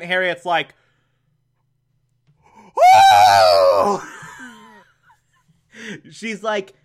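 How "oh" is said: loud, drawn-out "oh!" of shock; startled cry. Retold or imitated. Reviewer acting out Aunt Harriet after a hand kiss, startled cry. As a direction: belt out a mock startled "oh!".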